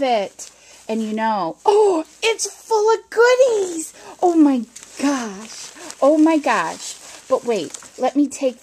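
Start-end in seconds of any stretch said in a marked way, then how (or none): 1.7-7.0 excited. Real, not acted. Finds the bag full of goodies.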